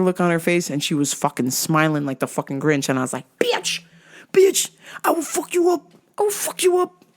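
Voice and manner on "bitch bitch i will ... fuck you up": high-pitched strained warning; mock threat. Teller warns the grinning woman, high mock threat.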